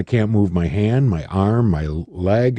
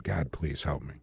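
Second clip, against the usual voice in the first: quiet prayer; 7 semitones lower, 15 dB quieter than usual. Retold or imitated. Eyes closed, praying.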